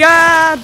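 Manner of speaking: sudden loud, high "ah"; startled yell. Real, not acted. Player caught out mid-apology, startled yell. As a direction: cry out mid-sentence.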